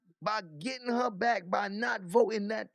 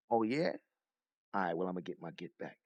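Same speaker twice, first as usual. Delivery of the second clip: lower, softer and fast petty retort; mock petty revenge; 4 dB quieter, faster than usual. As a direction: sneer it back quickly, low. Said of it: "it's just a series of".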